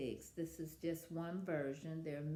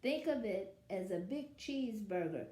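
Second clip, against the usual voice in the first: lively read-aloud as the wolf telling his side; story narration; 5 semitones higher, 3 dB louder than usual. A narrator's reading. Wolf narrator telling children his side, storytelling voice. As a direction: read it like a sly storyteller to kids. Reads picture book as wolf.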